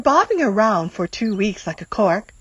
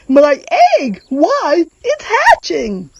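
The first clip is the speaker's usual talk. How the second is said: much higher, loud excited shout; 6 semitones higher, 5 dB louder than usual. Acted. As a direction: burst out as the egg cracks. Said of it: "shouted Horton".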